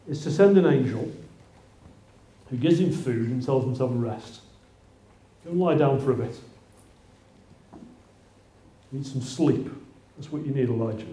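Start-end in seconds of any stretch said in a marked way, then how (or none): 5.5-11.0 soft, tender reassurance; a gentle comfort, lightly voiced. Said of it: "That's what God tells him".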